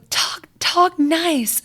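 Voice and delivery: put-on high, slow, bratty little-girl scolding; bossy kid telling her brothers off, slow and high; mean little girl. Little sister scolds whining brothers in a mean, high, slow voice. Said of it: "in the meanest voice".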